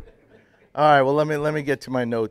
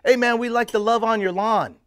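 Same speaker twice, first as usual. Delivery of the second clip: friendly call; 9 semitones higher, 5 dB louder than usual. Retold or imitated. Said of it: "I go".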